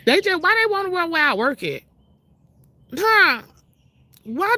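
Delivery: mocking the neighbor who asked where she works, high and nosy. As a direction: imitate her prying whine. Mocking the nosy woman's question.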